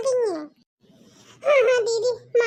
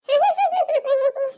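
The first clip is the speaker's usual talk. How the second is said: a short, higher gloating laugh; gloating cackle; 4 semitones higher than usual. Acted. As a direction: laugh like you've won at her expense. Gloating over the daughter-in-law.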